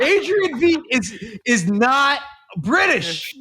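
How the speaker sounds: laughing. Real, not acted. Laughing joke about casting.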